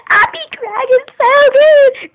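high, loud, slow rapturous shout; excited cry. Real, not acted. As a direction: wail it with delight. Raving about candy.

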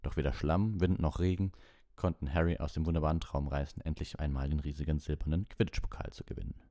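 ordinary delivery, nothing marked

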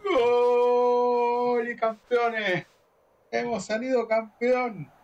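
long drawn-out goal scream; breakneck goal commentary. Real, not acted. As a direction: stretch every golazo at full volume. Winning goal, celebrating championship.

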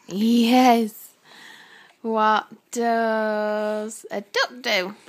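loud slow drawn-out question. Real, not acted. Playing animal game loudly.

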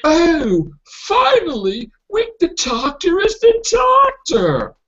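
high mocking fan voice. Podcaster mocking magazine critics, high and sneering. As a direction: sneer it like a smug fan finally converted. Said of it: "they're like".

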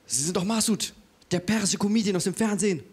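high, eager fan recognizing someone; imitated high-pitched excited recognition. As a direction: gush like a stranger spotting a celebrity. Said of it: "kam eine Frau auf mich zu und meinte".

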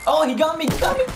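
shrieking. Real, not acted. Shot by enemy.

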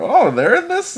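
high, loud mock surprise; excited recognition. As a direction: exclaim as if just spotting a familiar actor. Acts out spotting actors mid-movie.

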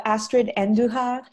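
Astrid Andujar in a light Spanish accent. Shown as a demonstration. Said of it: "I try to do the Spanish accent".

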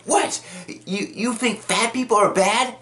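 high-pitched offended question; mock outrage. He challenges her on fat people, mock outraged. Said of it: "he's just getting all mad".

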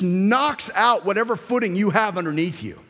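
high, emphatic preacher delivery; energetic pulpit delivery; forceful preaching. Acted. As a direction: preach it with mounting insistence.

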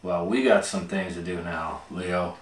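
ordinary delivery, nothing marked

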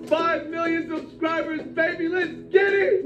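high-pitched, drawn-out victory cry; celebratory hype. Real, not acted. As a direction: whoop it out to celebrate the milestone. Celebrating a subscriber milestone.

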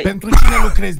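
loud barked interrogation; furious interrogator; angry demand. Acted. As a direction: demand it hard.

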